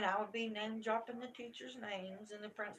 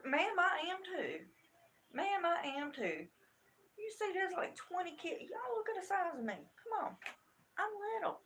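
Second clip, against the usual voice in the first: high-pitched, exclaiming protest; retold indignant insistence; 8 semitones higher, 3 dB louder than usual. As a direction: argue back with raised pitch, incredulous. Retold heated argument with teacher.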